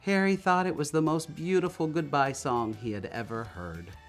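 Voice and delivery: usual speaking voice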